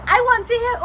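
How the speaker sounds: high, loud, fast pleading; desperate loud protest. Retold or imitated. To the nurses, in labour pain, a high loud protest. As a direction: cry out that you refuse to hear it.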